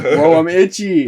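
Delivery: slow loud dog voice; loud, slow, whiny itchy dog. Retold or imitated. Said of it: "He's like".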